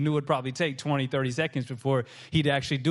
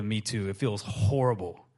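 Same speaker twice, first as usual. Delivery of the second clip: usual speaking voice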